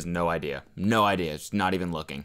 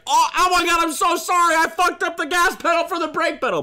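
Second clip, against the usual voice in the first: high, hammed-up flustered sorry; imitating a frantic driver, very high; mock panicked apology; 4 dB louder than usual. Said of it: "would be like".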